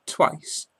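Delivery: ordinary talk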